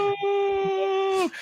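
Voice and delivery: very long, high-pitched held cry; hammed-up melodramatic anguish; mock despairing wail. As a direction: imitate a jilted suitor wailing after her. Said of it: "And the guy was like".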